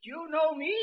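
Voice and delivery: put-on high, tearful old man's voice; high quavering old man asking. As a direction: play a shaky ninety-three-year-old. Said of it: "in a quaky ... quavering voice".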